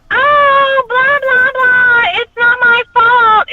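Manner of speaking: high, loud whining excuse; whiny mock excuse. Mocking people who blame others, with a high whine. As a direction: whine like someone dodging blame. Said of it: "It's always".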